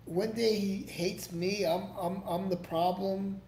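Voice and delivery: normal talking voice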